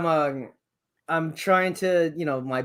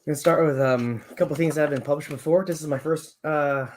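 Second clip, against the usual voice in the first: normal talking voice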